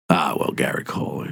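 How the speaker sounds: lightly voiced deep-voiced rival; Bruce Boxleitner impression. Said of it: "he's got a really deep voice".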